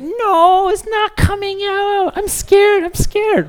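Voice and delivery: put-on very high, loud, frightened little girl; scared toddler daughter. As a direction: wail it like a scared child. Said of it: "She's like".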